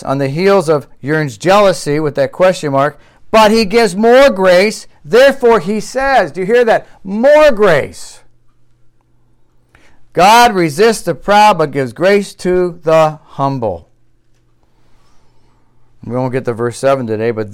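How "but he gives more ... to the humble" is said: high, loud, drawn-out pulpit delivery; impassioned preaching. Real, not acted. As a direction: drive it home like a fervent preacher. Preaches the verse forcefully.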